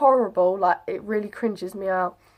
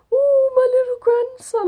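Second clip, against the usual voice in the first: very high, loud wail of a soppy grandma; mock crying; 5 dB louder than usual. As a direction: imitate a mum bawling with joy. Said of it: "like, crying".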